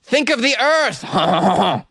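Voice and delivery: high-pitched hammy French plea; comic French accent ending in fake ha-has; mock French accent. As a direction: cry out like a cartoon Frenchman. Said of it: "That's how I do my French accent".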